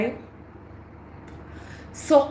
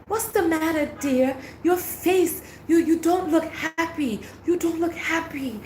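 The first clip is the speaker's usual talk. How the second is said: higher-pitched voice for the other woman; put-on solicitous lady, high voice; imitating concerned woman; 5 semitones higher than usual. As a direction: play her fussing over someone, pitched up. Voicing the second woman's concern.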